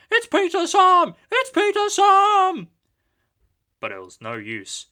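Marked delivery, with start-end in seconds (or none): 0.1-2.7 much higher, loud desperate cry; frantic warning. A narrator's reading. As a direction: plead with the trucks. Empty trucks warning.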